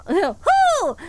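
high cheering hoot; excited whoop. Real, not acted. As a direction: whoop it up with glee. Cheering at the game.

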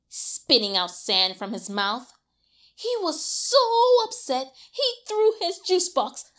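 ordinary delivery, nothing marked

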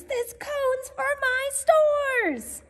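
slow, high insistent shout. A narrator's reading. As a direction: stretch the claim out high. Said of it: "shouted Bruce".

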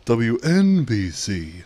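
slow, loud, swooping station call sign; Howard Stern. Retold or imitated. Imitating a radio bit for viewers, exaggerated station ID. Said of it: "I was watching Howard Stern the other day".

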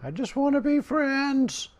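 high-pitched, playful calling after; mock pleading call. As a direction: coax a runaway foe in mock hurt. Calling after a fleeing enemy.

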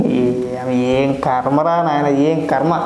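weary lament. Real, not acted. Lamenting the state of things.

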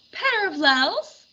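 high, slow drawn-out call. A narrator's reading. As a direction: call the dragons from afar. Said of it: "whistled and called out".

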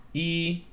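"一" held high and level; high first tone. Shown as a demonstration. Teacher marking tones on numbers, high level tone. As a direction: keep "一" on one high, even pitch. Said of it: "first tone".

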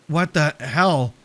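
slow, winded exclamation; out of breath from the stairs; heaving. A narrator's reading. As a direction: drag it out between heavy breaths. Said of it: "I heaved".

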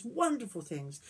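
ordinary delivery, nothing marked

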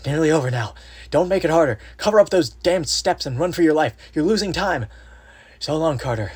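high, strained pleading that sinks at the goodbye; faint desperate farewell. A narrator's reading. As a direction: urge him away, then let the voice drop. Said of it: "then the faint voice of Warren".

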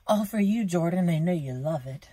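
playful teasing, rising to a whisper. Pretend. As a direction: tease him playfully. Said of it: "I'm just kidding".

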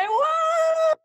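a long, high, loud wow on the edge of tears; high, slow, tearful cry of joy; overwhelmed wow. Real, not acted. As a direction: let the emotion break through the wow. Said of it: "lo logré".

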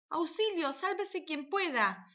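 high, slow squealing panic; shrieking ladies. Acted. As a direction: squeal like startled shoppers climbing cars. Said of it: "chillaban las señoras".

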